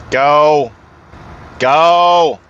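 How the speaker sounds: loud, impatient go, go; urging go. Real, not acted. Driver telling the tailgating car to pass, impatient. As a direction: wave the car past with your voice.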